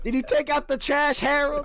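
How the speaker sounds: high nagging Bronx wife; nagging partner. Acted. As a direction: nag him about the chores. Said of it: "old couple arguing in the Bronx".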